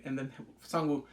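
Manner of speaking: ordinary delivery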